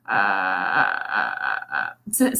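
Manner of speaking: very low bacon-sizzle creak on uh; creaky vocal fry rattle. Shown as a demonstration. Coach imitating bacon frying with low creaky fry. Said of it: "kinda sounds like".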